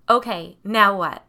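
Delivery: loud deadpan question; loud matter-of-fact. A narrator's reading. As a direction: say it loud and flat. Hunter back on phone.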